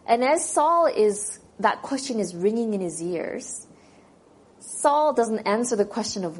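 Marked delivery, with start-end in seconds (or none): none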